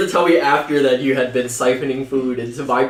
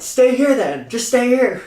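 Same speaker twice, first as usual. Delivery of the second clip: high-pitched imitation of a fed-up mom; exaggerated mom voice; 8 semitones higher, slower than usual. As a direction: order a child in a put-on high voice. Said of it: "she's like".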